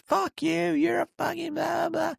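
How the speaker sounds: very high, mocking rant; high hammed-up tirade fading into blah-blah; mock berating. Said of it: "really berating me".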